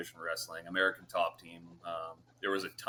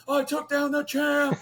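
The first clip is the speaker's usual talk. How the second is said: much higher, louder, slow triumphant crow; gleeful boast; 6 dB louder than usual. Retold or imitated. Fighter crowing after taking down the champ, gleeful. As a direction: savour the win out loud. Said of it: "And I was like".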